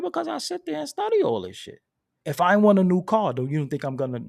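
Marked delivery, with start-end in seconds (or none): 0.0-1.8 high, emphatic boast; a much higher, proud retort. Retold or imitated. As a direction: show off that you did the homework. His retold reply to dealer.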